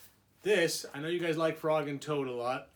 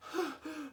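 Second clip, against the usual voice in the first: gasp; 12 semitones higher, 6 dB quieter than usual. Acted. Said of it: "gasped Frog".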